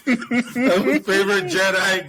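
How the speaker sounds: high, loud, slow laughing line. Real, not acted. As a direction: laugh through the words. Laughing mock praise of a minor character.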